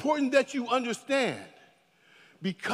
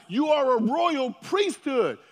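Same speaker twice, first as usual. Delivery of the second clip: usual speaking voice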